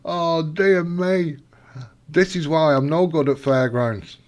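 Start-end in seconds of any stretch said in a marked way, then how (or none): 0.1-1.4 slow rueful sigh-words; amused dismay. Real, not acted. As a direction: drag it out ruefully. Amused despair.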